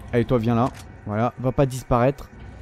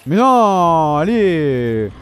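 louder exasperated no; frustrated groan; 2 semitones higher, 5 dB louder, much slower than usual. Real, not acted. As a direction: protest at the game. Frustrated at bad luck.

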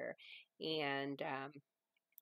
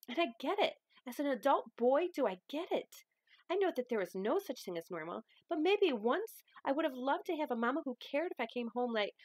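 louder, rising, hurt protest at her grandma; hurt outburst; 10 semitones higher, 6 dB louder than usual. Acted. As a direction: pour out years of hurt, voice pushed. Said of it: "I'm gonna probably use a little bit of voices".

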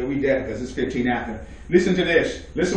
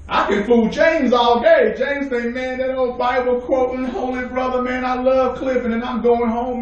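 high, raised preacher's voice acting out a hypocrite; full-force preaching; 8 semitones higher than usual. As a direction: preach it in one high, driving run.

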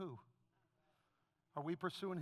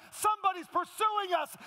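loud preaching; 9 semitones higher, 7 dB louder than usual. Acted. Repeated preached climax line.

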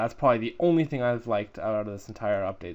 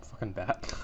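normal talking voice

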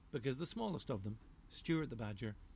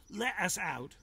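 demanding badger; 6 semitones higher, 5 dB louder, slower than usual. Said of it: "said Harry the Badger".

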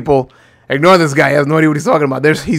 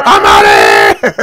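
deep mock character shout; 7 dB louder, slower than usual. Voicing fleeing game character.

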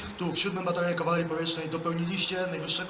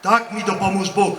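loud, measured oath line; formal oath dictation; 3 semitones higher, 10 dB louder than usual. Real, not acted. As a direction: declaim each phrase for the ranks.

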